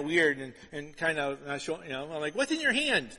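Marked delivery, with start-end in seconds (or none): 2.3-3.2 suspicious parent. Retold or imitated. Father confronts daughter holding something.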